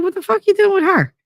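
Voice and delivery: very high mock shriek of disbelief; incredulous outrage. To a friend dating the wrong girl, high shocked outrage. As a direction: demand to know why, shocked.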